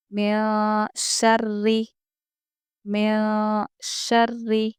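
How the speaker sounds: very slow मिन शर्री with a doubled, thin ra; thin ra recitation. Shown as a demonstration. Teacher reciting मिन शर्री slowly with a thin ra. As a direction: draw out मिन शर्री, the ra light. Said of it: "हम इसको बारीक पढ़ेंगे".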